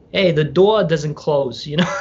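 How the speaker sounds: playing the older New Yorker in a New York accent. Imitating the fifties-something New Yorker's accent, slow and loud. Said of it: "he's got a real New York accent, you know, he talks like this".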